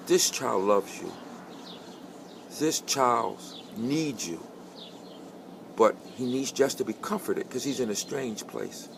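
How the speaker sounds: put-on slow, hushed and tender, reassuring the young father; soft comforting voice. Gently comforting the young father in the bathroom, slow and soft. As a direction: ease him gently into the truth.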